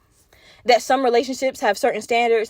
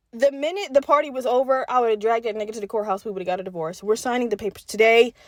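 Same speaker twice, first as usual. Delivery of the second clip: usual speaking voice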